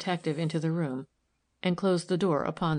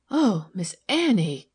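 a mild dismayed cry, drawn out; a lightly played gasp of alarm, slow; wheezing gasp; 3 semitones higher, 5 dB louder, slower than usual. A narrator's reading. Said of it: "The voice wheezed and gasped".

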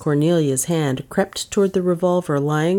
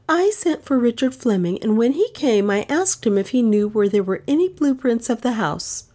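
put-on a lightly raised young woman's voice; Dale explaining; 6 semitones higher than usual. Dale telling the detective her story in a lightly higher voice. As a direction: tell it carefully, pitched a little higher.

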